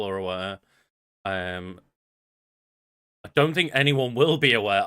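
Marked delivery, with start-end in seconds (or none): 3.2-4.8 loud, raised, punched-up delivery; oddly emphatic. Real, not acted. Podcaster plugging tomorrow's stream, oddly emphatic. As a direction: come out louder than the thought needs. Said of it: "I don't know why I said it like that".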